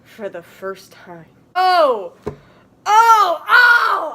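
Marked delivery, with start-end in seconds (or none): 1.5-4.1 loud, rising mock cries of pleasure; hammed-up screams. Reader hamming up the sex scene, loud mock screams. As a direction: ham up the ecstatic cries. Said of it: "I screamed".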